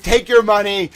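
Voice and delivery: loud, high mock defiance; indignant outburst. Streamer acts rejecting the money, indignant outburst. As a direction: throw the offer back loudly. Acted walkout over money.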